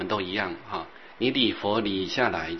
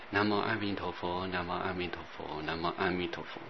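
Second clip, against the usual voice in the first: low-key, softer Buddha-name recitation; 3 semitones lower, 7 dB quieter than usual. Shown as a demonstration. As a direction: recite quietly, sinking lower as it repeats. Murmured Buddha-name recitation between remarks.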